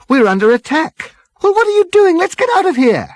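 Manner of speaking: very high, loud panicked cry; panicked shout. Acted. Crew under Vogon fire, panicked and loud. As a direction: shriek it out in panic.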